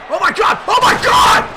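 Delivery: very high, stunned, stammering shout; real squealing commentator shock; shocked yelling. Real, not acted. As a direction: cry out in disbelief at a surprise intruder. Shock at surprise intruder.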